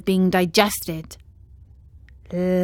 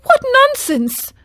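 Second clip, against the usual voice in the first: high, loud scornful cry; high loud scoffing cry; 5 dB louder than usual. A narrator's reading. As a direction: scoff at the idea. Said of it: "cried Pinocchio".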